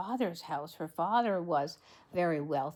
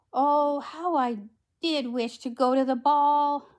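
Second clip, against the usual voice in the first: wishful Cinderella; 6 semitones higher, 5 dB louder than usual. A narrator's reading. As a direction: play Cinderella longing aloud. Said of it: "said Cinderella".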